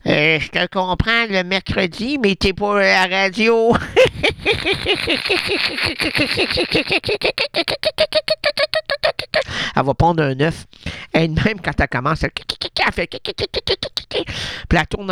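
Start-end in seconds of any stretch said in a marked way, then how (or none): 3.8-9.7 high clucking cackle imitation; rapid high tet-tet clucking; hen cackle. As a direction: cluck like a hen about to lay.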